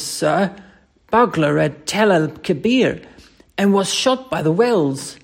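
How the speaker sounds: earnest, protesting Cockney soldier; Cockney dialect. Acted. Thomas insists to Mister Scott he's no coward, earnest Cockney. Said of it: "Thomas' Cockney dialect".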